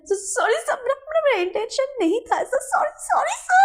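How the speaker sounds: high, tearful stammering sorry; crying apology. Acted. Servant apologising to his boss through tears. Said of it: "वो बोलता है".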